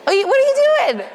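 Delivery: high, laughing tease; playful teasing. Real, not acted. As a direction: tease them, high and giggly. Teasing a shy client.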